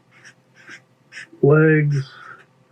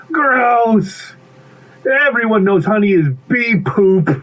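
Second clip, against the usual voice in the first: high, drawn-out disgusted character voice; grossed-out pea; 8 semitones higher, slower than usual. Acted. Pea refuses bee's honey, grossed out. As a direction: pitch up with childlike disgust.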